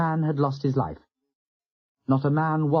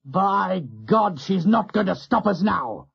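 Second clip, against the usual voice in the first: fierce oath; 6 semitones higher, slower than usual. A narrator's reading. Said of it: "He swore fiercely".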